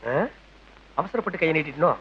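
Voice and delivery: low, soft second thoughts; subdued worried aside; muttering to himself. Acted. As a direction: think aloud under your breath, uneasily. Man worries to himself afterwards.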